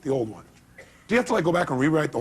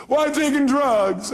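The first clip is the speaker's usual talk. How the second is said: imitating caller; 9 semitones higher, 3 dB louder, slower than usual. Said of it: "She goes".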